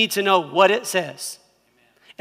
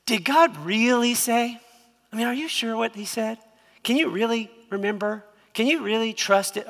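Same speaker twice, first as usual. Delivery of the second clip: insinuating serpent; 4 semitones higher than usual. Retold or imitated. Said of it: "He said to the woman".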